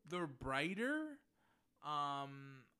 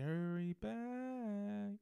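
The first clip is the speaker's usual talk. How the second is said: slow, drawn-out eerie whisper-call; ghostly bride; 5 semitones higher, much slower than usual. Retold or imitated. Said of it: "where she's like".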